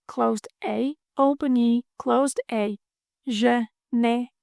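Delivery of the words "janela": janela drawn out slowly, syllable by syllable; slow practice. Shown as a demonstration. Teacher slows janela down for learners to copy. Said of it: "practice repetition".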